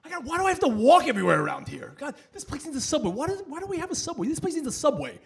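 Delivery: high-pitched, exasperated griping; frustrated rant. Retold or imitated. Frustrated New Yorker on vacation having to walk everywhere, griping high. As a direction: complain in a high, put-out whine. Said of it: "I'm just getting frustrated".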